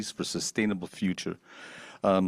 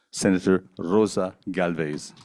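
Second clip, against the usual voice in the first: slow, raised presenter's call of the name; announcing the speaker. Real, not acted. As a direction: welcome her up to the stage with emphasis. Host announces speaker to stage.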